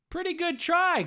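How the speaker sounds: very high, loud cartoon hero voice; Goku voice. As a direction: pitch way up like Goku. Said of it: "that sounded kinda like Goku".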